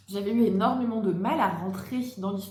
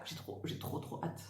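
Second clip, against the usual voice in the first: excited whisper; 4 semitones lower, 13 dB quieter than usual. Real, not acted. Eager whisper before plain repeat.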